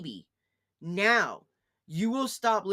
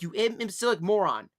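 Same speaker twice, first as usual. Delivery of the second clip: normal talking voice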